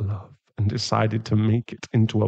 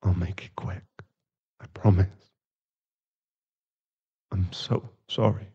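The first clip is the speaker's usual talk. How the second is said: soft-spoken; 8 dB quieter than usual. Acted. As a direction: speak softly, like a tender goodbye. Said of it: "his voice is soft when he speaks".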